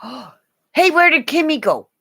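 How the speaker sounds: where did she go, sudden surprise; surprised. Real, not acted. Gasps at a missing viewer.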